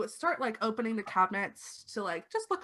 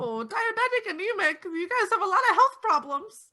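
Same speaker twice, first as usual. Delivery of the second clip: much higher, louder granny fussing; doting old lady; 10 semitones higher, 4 dB louder than usual. Acted. Old lady fusses over the vampire who broke her glass. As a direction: coo over the guest.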